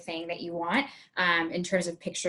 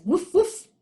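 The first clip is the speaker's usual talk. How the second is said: high, louder playful woof woof; bright woof; 9 semitones higher, 5 dB louder than usual. Shown as a demonstration. Teacher barking as the dog clue. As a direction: bark it out for the kids.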